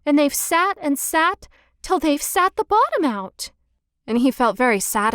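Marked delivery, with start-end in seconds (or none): 0.1-3.5 higher, a little squeaky bear cub; storybook baby bear, higher pitched. A narrator's reading. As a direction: pipe it up like a tiny bear. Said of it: "squeaked the Baby Bear in his shrill little voice".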